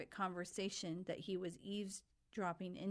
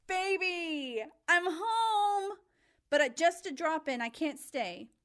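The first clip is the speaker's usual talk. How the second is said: excited greeting; 9 semitones higher, 8 dB louder than usual. Acted. Sophie bursts in greeting husband.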